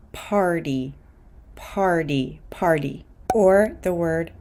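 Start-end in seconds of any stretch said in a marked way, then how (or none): 0.1-3.0 "party" said like "pardy"; fast D for T. Shown as a demonstration. Teacher models American "party" with a fast D. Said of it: "So the pronunciation here is".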